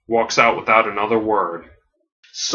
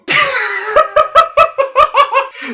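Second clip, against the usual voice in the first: long, high burst of laughter; real high staccato laughing fit; big cackling laugh; 4 dB louder than usual. Real, not acted. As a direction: lose it completely at your own story. Laughs at the toy ring.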